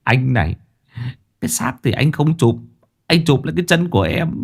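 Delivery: aggrieved henchman's whine; grimacing complaint. Acted. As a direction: grumble peevishly at the boss. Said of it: "Thằng Sáu nhăn nhó".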